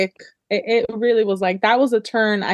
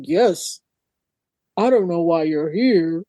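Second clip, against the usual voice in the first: low, slow mock villain voice; audiobook Cain voice; 5 semitones lower, slower than usual. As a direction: imitate a narrator putting on a deep man's voice. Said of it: "she would change her voice for him".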